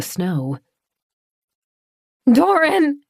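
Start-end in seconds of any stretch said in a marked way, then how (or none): 2.3-3.0 high, loud scream of his name; scream, voice breaking. Acted. Claire screaming at unmoving Doran, voice breaking. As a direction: scream it so the voice cracks. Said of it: "She screamed his name, her voice breaking".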